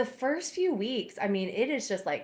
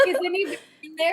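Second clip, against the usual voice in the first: high, fast mock stall call; quick high knock-knock voice; asking through the door; 9 semitones higher, 5 dB louder, much faster than usual. Friend checking the stall, high and fast. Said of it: "They're like".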